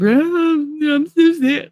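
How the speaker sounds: high, hammed-up fake sob; mock whining. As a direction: parody a whiny crybaby with a fake sob. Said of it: "whining and crying".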